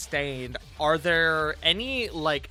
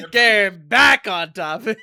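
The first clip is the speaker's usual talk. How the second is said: slow, laughing through the words; 3 semitones higher, 9 dB louder, slower than usual. Real, not acted. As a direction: giggle it out slowly. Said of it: "before we say something too offensive".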